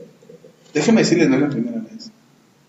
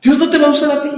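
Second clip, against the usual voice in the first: the devil taunting; 10 semitones higher than usual. Retold or imitated. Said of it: "El diablo se aprovecha ... para decirnos".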